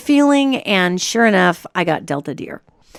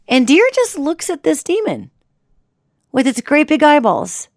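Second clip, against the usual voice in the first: ordinary talk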